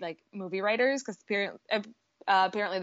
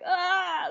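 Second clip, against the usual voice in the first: a sudden high choked cry of emotion; 9 semitones higher than usual. Retold or imitated. Recalling the Avengers funeral shot, a high choked cry. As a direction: let out a short high sob-like cry. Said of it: "that's when I was like".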